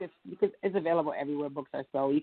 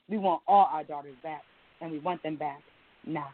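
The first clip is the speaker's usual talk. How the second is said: rhythmic, insistent poetry performance with repeated refrains; spoken word poem; 3 semitones higher than usual. Acted. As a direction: declaim it like a protest poem on stage. Recites her spoken word poem.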